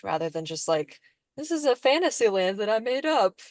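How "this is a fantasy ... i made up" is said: higher, swooping make-believe storyteller voice; lilting, hammed-up show-and-tell; playful mock pitch. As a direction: present an invented world with playful, sing-song flourish. Mocking up a fantasy writer's pitch.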